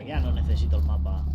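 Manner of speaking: ordinary delivery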